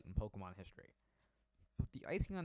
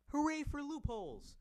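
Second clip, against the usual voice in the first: squeaky gleeful cheer; high cheer; 4 dB louder than usual. Real, not acted. Cheering a loophole.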